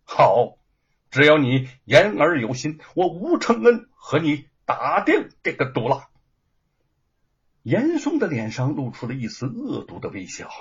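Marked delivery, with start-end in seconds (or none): 0.0-6.1 firm and loud, no hesitation; declaring his bet out loud; resolute. A narrator's reading. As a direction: commit to the wager with full force. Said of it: "毫不犹豫地说".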